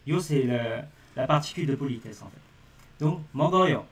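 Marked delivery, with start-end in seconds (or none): none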